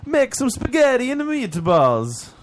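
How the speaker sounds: high, slow hammed-up Italian accent; sing-song fake Italian accent, stretched out; mock Italian accent. Mocking the singer with a hammed-up high Italian accent.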